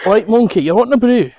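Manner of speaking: put-on playful Yorkshire accent hollered at a monkey. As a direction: shout it out in a broad Yorkshire accent. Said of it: "That's a Yorkshire accent".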